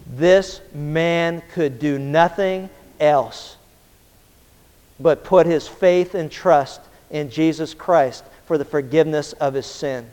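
slow right down for weight; solemn. Real, not acted. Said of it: "slowed solemn emphasis".

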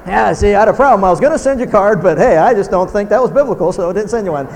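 loud, sarcastic put-on excuse; hammed-up self-justifying excuse; mock excuse. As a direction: play the smug skipper of the card. Mock excuse for not sending card.

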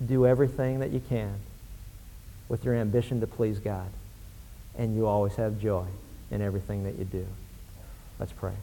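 softening into prayer; quiet close. Real, not acted. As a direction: bring the voice down toward prayer. Closing words before prayer.

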